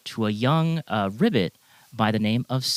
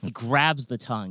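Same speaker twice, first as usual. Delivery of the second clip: ordinary delivery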